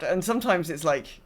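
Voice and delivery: usual speaking voice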